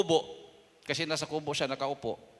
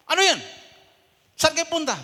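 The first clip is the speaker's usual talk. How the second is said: much higher, loud armed challenge; shouted challenge; 13 semitones higher, 9 dB louder than usual. Retold or imitated. Abu Sayyaf fighters challenging the pastors, shouted. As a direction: bark it like a gunman stopping strangers.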